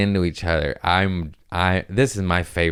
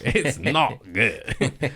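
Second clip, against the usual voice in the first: slow, high, bad Australian accent; laughing attempt at an Australian accent; Jim Jeffries; 6 semitones higher, much slower than usual. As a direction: drag out a mock Aussie accent, breaking into laughter. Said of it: "I can't do accents".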